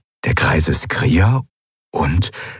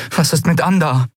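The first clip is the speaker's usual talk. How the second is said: higher pressing question; urgent; 10 semitones higher than usual. A narrator's reading. Endriel pressing Kai about Ander, urgent. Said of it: "drängte Endriel".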